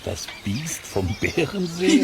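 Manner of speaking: hoch und langsam, verblüfft nachfragen; ungläubig staunend. Acted. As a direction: kann das wahr sein?. Ungläubige Rückfrage des Anglers. (Angler fragt den Wirt ungläubig nach, höher und langsam)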